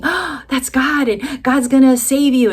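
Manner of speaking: high, breathless burst of hope; excited hope. Retold or imitated. Wife reliving her hope that God will save her husband, excited. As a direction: relive a rush of hopeful certainty.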